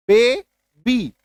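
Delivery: "Baby" in two separate beats, pausing between; broken into syllables. Shown as a demonstration. Teacher demonstrating two syllables, "baby" split by a clear pause. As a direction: say "baby" haltingly, one syllable at a time. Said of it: "दो बार में बोला ना रुक रुक के".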